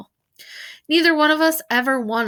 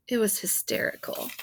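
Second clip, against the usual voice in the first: whispered sarcastic aside; dry sarcasm; 2 semitones lower, 8 dB quieter than usual. A narrator's reading. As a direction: hush it, dripping with irony.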